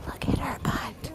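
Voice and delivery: unvoiced hushed whisper. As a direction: whisper like a wildlife filmmaker. Hushed nature-film whisper.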